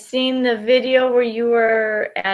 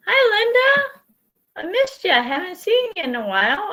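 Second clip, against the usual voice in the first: excited greeting; 10 semitones higher, faster than usual. Real, not acted. Greeting a returning viewer.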